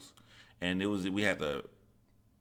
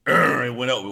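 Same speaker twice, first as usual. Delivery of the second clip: louder, slower, drawn out; building amazement; 4 semitones higher, 7 dB louder, slower than usual. Retold or imitated. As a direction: savour the moment it rose. Ladder going up, told slowly.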